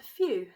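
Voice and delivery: a loud, relieved phew. A narrator's reading. Dog relieved after the dragon flies off, loud phew. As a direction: blow out relief. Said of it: "said the dog".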